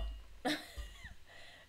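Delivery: sudden high giggle, breathy; high burst of laughter. Real, not acted. Mother laughing as son blocks her texts, giggle. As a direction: let a big laugh rise high.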